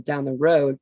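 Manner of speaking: normal talking voice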